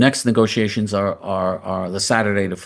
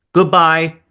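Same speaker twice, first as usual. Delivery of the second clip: higher, louder, curt farewell; dismissive goodbye; 7 semitones higher, 7 dB louder than usual. Real, not acted. Host on leaving after bombing, a dismissive loud goodbye. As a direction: wave them off dismissively.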